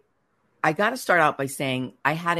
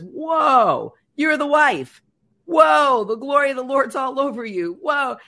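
loud and much higher, exclaiming "Whoa"; amazed shout; 8 semitones higher, 6 dB louder than usual. Retold or imitated. An older gentleman greeting the wife, shouting high in amazement.

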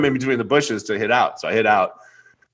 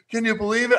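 mock amazed; 13 semitones higher than usual. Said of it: "I'm going".

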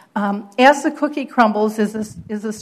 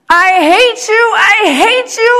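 screaming mother; 12 semitones higher, 10 dB louder than usual. Retold or imitated. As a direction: scream it in fury at your granddaughter. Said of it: "I hear my mom screaming".

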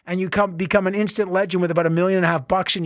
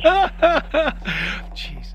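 laughing jeez; 7 semitones higher than usual. Real, not acted. Host laughing at the show's end.

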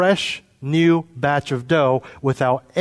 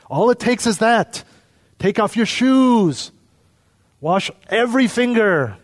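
mock parent voice; 7 semitones higher than usual. Preacher mimicking a parent instructing young kids, high and sing-song. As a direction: play the fussy parent giving orders.

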